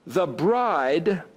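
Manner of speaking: ordinary delivery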